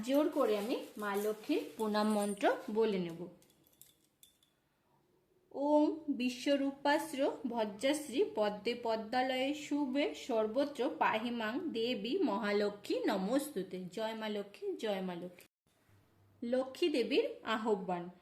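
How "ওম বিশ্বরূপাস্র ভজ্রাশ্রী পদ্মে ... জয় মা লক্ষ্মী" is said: soft, slow, reverent Sanskrit mantra; mantra recitation. Acted. Devotee reciting the pranam mantra to Lakshmi, soft prayerful intoning. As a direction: intone the prayer with folded hands.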